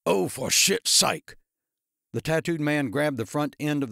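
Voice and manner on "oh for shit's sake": exasperated. A narrator's reading. Tattooed man exasperated.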